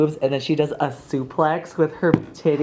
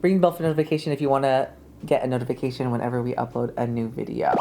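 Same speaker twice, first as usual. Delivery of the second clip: fast; 3 semitones lower, faster than usual. Shown as a demonstration. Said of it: "I was talking really fast".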